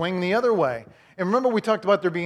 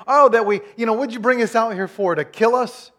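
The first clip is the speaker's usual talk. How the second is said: louder, higher whiny grumble; whining complaint; 5 semitones higher, 6 dB louder than usual. Retold or imitated. Israelites complaining to Moses, loud whine. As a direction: whine like an ungrateful crowd. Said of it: "whining and complaining".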